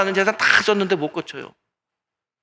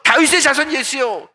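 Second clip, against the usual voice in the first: high, loud plea; crying out with all her might; 7 semitones higher, 5 dB louder than usual. Retold or imitated. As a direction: shout it with everything she has. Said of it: "있는 힘껏".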